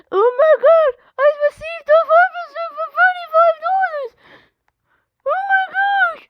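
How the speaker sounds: high, fast, thrilled shouting; excited shriek. Real, not acted. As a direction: shriek with delight. Scammed harvester reveal.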